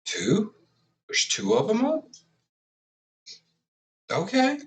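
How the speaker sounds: high-pitched, puzzled questioning; questioning, rising high in mock confusion; acted bafflement. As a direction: replay a baffled double take, pitch climbing high. Acting out own confusion at titles.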